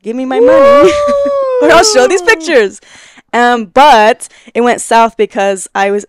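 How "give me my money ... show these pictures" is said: high, loud hammed-up demand; squealed mock ultimatum, laughing midway; mock blackmail threat. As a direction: play-act a blackmailer, pitched way up and loud.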